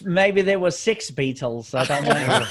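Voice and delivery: hammy Oklahoma accent attempt. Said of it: "That's my Oklahoma accent from England".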